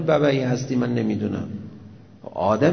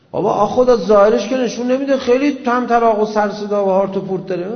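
much louder and higher, full-force pulpit delivery; forceful preaching; 5 semitones higher, 9 dB louder than usual. Real, not acted. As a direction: drive it home like a fiery preacher.

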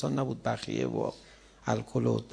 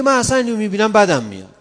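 scornful mimicry; 11 semitones higher, 10 dB louder, faster than usual. Mimicking a spiteful person.

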